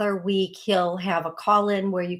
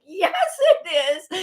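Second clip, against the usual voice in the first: high, louder playful insisting; teasing insistence; 10 semitones higher, 4 dB louder than usual. Retold or imitated. Peter insisting she really talks that way, playful high retort. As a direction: insist back like a teasing friend. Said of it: "He's like".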